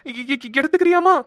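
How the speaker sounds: stammering fear. Real, not acted. Scared husband tells wife where her phone is, stammering. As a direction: stammer out of fright. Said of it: "பயமா இருக்கு இவளை பார்த்தாலே".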